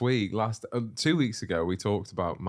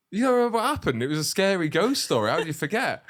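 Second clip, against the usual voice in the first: high, loud incredulous disbelief; retold disbelief; 10 semitones higher, 5 dB louder, faster than usual. Retelling his baffled call to his brother, high and loud with disbelief. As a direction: cry out in shocked disbelief at him. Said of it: "And I go".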